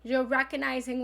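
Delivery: ordinary delivery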